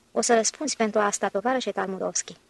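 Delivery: plain reading voice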